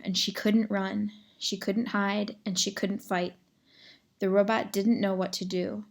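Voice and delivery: ordinary delivery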